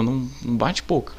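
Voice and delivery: ordinary delivery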